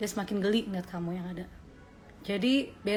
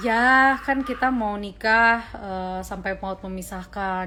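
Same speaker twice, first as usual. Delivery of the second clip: a slow, patient demo of her stock answer; slow, measured reply to nosy relatives; slow, deliberate answer; 5 dB louder, slower than usual. Shown as a demonstration. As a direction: slowly spell out kita mau nikah and sampai maut memisahkan. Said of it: "aku biasanya menjawabnya begini".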